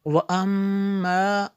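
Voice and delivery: वअम्मा with the m held as a long nasal hum; ghunna. Shown as a demonstration. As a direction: linger on the doubled m of वअम्मा. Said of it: "मीम शद्दत गुन्ना होगा". (वअम्मा की मीम पर लंबा गुन्ना)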